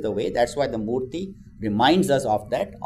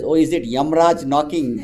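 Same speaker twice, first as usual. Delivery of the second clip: laughing question; 5 semitones higher, 6 dB louder than usual. Acted. Joking about the knock at the door.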